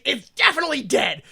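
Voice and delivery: usual speaking voice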